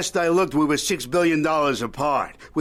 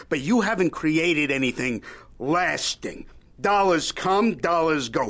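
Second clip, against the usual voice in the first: normal talking voice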